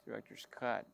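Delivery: usual speaking voice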